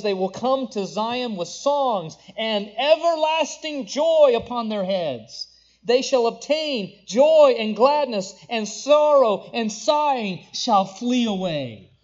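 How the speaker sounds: loud, rising scripture proclamation; preaching at full force. Acted. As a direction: proclaim it to the whole congregation.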